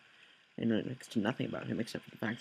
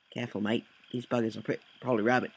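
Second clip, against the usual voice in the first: put-on amateurish Australian accent, louder at the end; bad Australian accent; 5 dB louder than usual. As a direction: attempt a hammy Australian accent. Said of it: "that is my horrible Australian accent".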